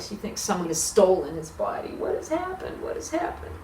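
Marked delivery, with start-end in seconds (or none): 1.9-2.8 high, softer anguished question; bewildered cry. Acted. Mary at the tomb, asking high and bewildered. 2.8-3.5 a breathless whispered echo. Acted. Mary at the tomb, whispering the question again.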